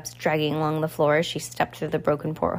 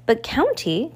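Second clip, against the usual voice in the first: a lightly voiced, whiny little girl, higher; whining girl; 6 semitones higher, slower than usual. A narrator's reading. Spoiled girl begging Count Olaf, lightly voiced whine. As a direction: wheedle it like a spoiled child. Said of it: "Carmelita whined".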